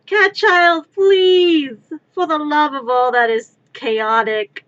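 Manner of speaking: loud, high pleading wail; loud desperate plea to the meowing cat; exasperated plea to cat. Real, not acted. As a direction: beg the cat to stop, voice cracking.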